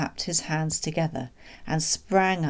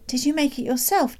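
put-on high-pitched child's question; curious boy; 10 semitones higher, 3 dB louder than usual. Boy asking his father about the pram, curious. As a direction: ask with eager curiosity.

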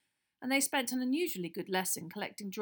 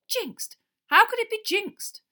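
much higher, louder indignant cry; outraged Wood; 14 dB louder, slower than usual. A narrator's reading. Wood to Harry, high and outraged. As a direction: protest in disbelief.

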